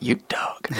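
breathy whispered aside. Acted. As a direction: hush it, sly and impressed. Whispered aside about Billy.

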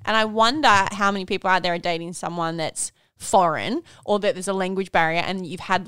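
normal talking voice

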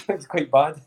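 high breathy laughter around the words; laughing. Real, not acted. Joking about childhood drinking, laughing through it.

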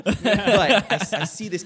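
high, loud, slow start through laughter; giggling into the story; laughing as she begins. Real, not acted. Laughing while telling own story.

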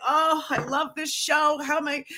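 high, slow, mock-wailed heartbreak; a hint of self-pitying wail; mock lament. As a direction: whine it like a lost love, slowly. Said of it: "I was like".

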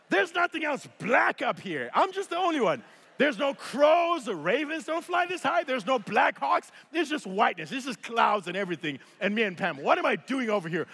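high-pitched mock-panicked rant; rising alarm played for laughs, easing slightly at the end; comic freaking out. First-time jumper realizing he's out of place, comically panicked. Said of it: "This is why I'm freaking out".

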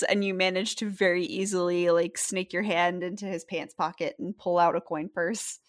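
ordinary talk

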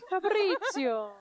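put-on slow, drawn-out Italian mob accent. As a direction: stretch it like an Italian mobster. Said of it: "'Cause we always went, "Fabrizio".

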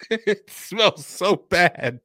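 grossed-out groan at the smell; disgusted by smell. Real, not acted. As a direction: recoil from a foul smell, laughing. Sniffing awful drink.

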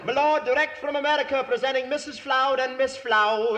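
pompous butler's announcement; formal announcement. Acted. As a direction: announce them grandly like a stuffy butler.

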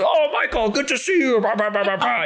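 high, hammed-up old colleague hello; mock hearty greeting. Old professors greeting each other.

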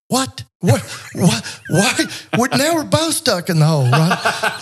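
high, incredulous "what?" with laughter; bewildered squeak, laughing through it; surprised. Retold or imitated. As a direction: play the man in the hole, baffled and laughing. Man in hole baffled.

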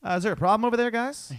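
imitating the comedian pointing out a heckler; comedian calling out. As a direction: needle the table sarcastically. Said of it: "he's just like".

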